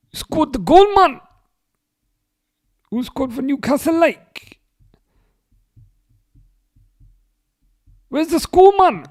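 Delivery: put-on high, loud, shouted Geordie accent. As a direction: shout it like a Newcastle fan. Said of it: "descend into Geordie accent".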